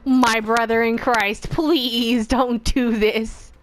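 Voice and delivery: slow drawn-out begging; hammed-up slow plea; mock pleading. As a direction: drag it out like a desperate prayer. Begging the game not to.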